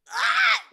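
very high, loud shriek; outraged scream. Real, not acted. Player who just lost the egg hunt, outraged shriek. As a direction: scream at the injustice.

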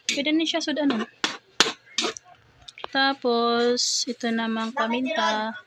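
ordinary talk, nothing marked

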